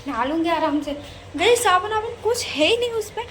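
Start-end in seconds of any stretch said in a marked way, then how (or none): 1.4-3.2 exasperated. Real, not acted. Exasperated retelling of missing soap.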